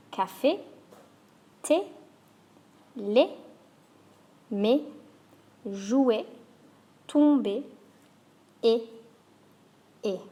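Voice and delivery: "Café", "thé", "lait" with a short sharp French é; clipped French é endings; French é words. Shown as a demonstration. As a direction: demo each word with a closed é. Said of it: "Let's go through those words again".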